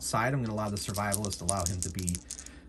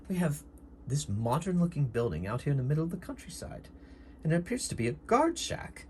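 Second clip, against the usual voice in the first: higher, lilting in-character voice; curious doctor character; 5 semitones higher than usual. Acted. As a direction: muse in the doctor's put-on voice.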